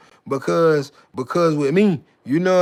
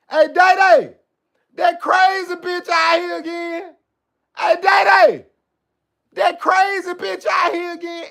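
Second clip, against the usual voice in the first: high shrill shout to a friend across the class; high yelling schoolkid pointing out the crazy girl; kid calling out; 12 semitones higher, 4 dB louder than usual. Acted. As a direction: holler at Day Day like a kid in the hallway.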